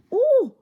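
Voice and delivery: high, loud "oh!" of frustration; sudden exasperated yelp; startled cry. Real, not acted. Startled by microphone level again.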